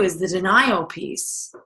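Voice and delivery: ordinary talk, nothing marked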